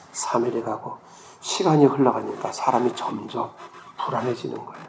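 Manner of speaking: usual speaking voice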